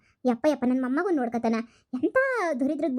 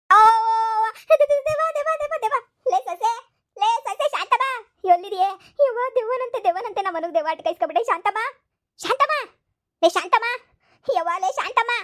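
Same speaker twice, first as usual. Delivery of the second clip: high, loud panicked calls for Shantamma; hammed-up terrified screaming; 8 semitones higher, 3 dB louder than usual. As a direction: scream for help from the ghost. Grandma screams for daughter-in-law.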